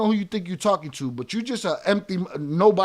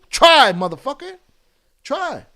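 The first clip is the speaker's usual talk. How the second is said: loud, higher challenge; daring shout; 6 semitones higher, 8 dB louder than usual. Real, not acted. Streamer daring haters to say it to him, loud. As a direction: throw the dare in his face.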